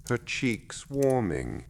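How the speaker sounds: ordinary delivery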